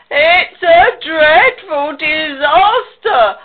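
loud, high, long-drawn wail; hammed-up lament at full volume; theatrical wail. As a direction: wail every word out slowly. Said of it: "wailed Pig".

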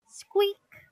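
high, loud squeak; sudden high-pitched toy noise; squeaky toy imitation.

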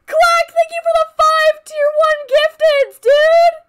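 high loud ecstatic thank-you; thrilled thanks. Real, not acted. Streamer thanking a viewer for gift subs, ecstatic shout. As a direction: gush at the top of your voice.

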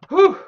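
high, loud relieved whoo; loud whoop. Real, not acted. Streamer finally finds a furnace, loud relieved whoop.

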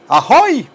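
short high swooping cry; cumbia whoop. Acted. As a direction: cap the song with a festive holler. Cumbia whoop after singing.

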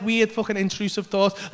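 ordinary talk

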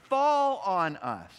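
a slow pleading wail; desperate plea. Acted. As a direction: drag it out in terror. People begging hills to fall.

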